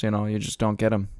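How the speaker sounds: normal talking voice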